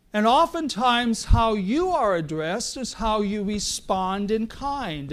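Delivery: usual speaking voice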